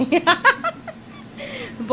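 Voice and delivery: laughs high at her own joke; a bright giggling burst; high burst of laughter. Real, not acted. Laughing at own sales pitch.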